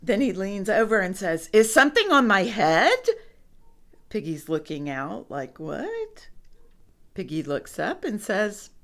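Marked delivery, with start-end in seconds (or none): 1.5-3.0 loud, quick, rising question; worried elephant, voice raised; alarmed question. A narrator's reading. Elephant alarmed, acted in story. 5.6-6.3 small, very high questioning squeak; soft, baffled high-pitched query; puzzled. A narrator's reading. Piggy puzzled, acted in story.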